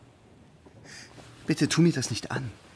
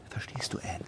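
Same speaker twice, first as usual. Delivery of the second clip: whispered; 3 semitones lower, 13 dB quieter than usual. Acted. Eindringliche Frage, heimlich.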